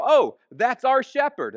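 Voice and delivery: the flock perking up, delighted to hear him; sheep recognizing shepherd. Retold or imitated.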